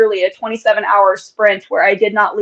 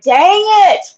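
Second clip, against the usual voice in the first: a playful yell, pitch jumping up high on the end; mock yell; 11 semitones higher, slower than usual. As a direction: yell it across the house in mock frustration. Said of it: "I yelled at her from across the house".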